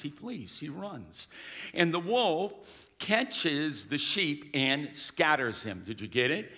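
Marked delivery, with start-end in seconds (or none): 0.0-1.3 flees and runs barely voiced, much softer; sudden hush; dropped to near silence. Real, not acted. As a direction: let the words fall away to nothing. Dramatic sermon description.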